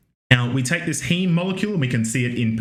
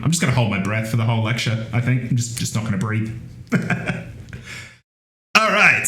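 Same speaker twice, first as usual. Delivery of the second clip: ordinary talk, nothing marked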